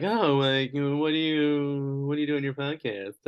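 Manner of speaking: mock questioner. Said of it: "they're like".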